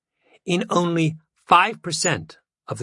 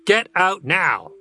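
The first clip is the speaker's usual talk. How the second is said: firm, measured command, pitched higher; slow, clear order to leave, mildly raised; loud, clear voice; 5 semitones higher, slower than usual. A narrator's reading. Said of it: "said in a loud, clear voice".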